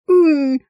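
high, loud pained moan. A narrator's reading. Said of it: "простонала Итрия". (громкий высокий стон)

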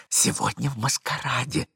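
normal talking voice

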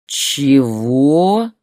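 loud, drawn-out rising growl. A narrator's reading. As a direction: growl it in angry disbelief. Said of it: "прорычал Борис в ответ". (грозный рык)

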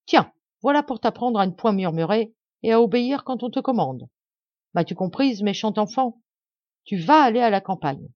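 high, loud, rising fury; furious scolding. Acted. Stepmother berates the crying girl, loud and furious.

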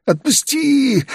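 high, drawn-out tearful plea; agonised high wail, begging for release; frightened cry. A narrator's reading. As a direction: wail it high in pain and fear. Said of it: "Он испуганно глядел на меня".

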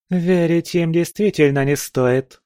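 a hint of a proud winged warrior; lightly voiced Valkyrie. A narrator's reading. (чуть выше, холодный голос Валькирии)

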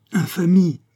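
sharp high indignant outcry; outraged cry. A narrator's reading. Biot, horrified at the killings, cries out in outrage. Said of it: "S'écria Biot".

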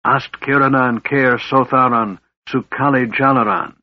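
a mage's forceful casting chant; intoned arcane incantation; spell incantation. A narrator's reading. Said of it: "Raistlin spoke".

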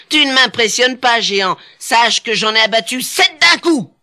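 high-pitched boastful little hero; cocky little tailor. A narrator's reading. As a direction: brag fearlessly up at the giant. Tailor boasts back to the giant.

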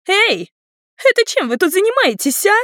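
high-pitched, accusing shriek. A narrator's reading. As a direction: shriek it from above, high and loud. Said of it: "завизжала над нами". (пронзительный визг)

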